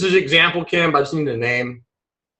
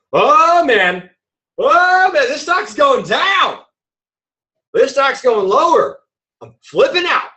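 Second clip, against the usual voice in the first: panicked trader; 9 semitones higher than usual. Retold or imitated. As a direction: play the trader losing his nerve as the stock drops. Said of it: "Kim gets scared".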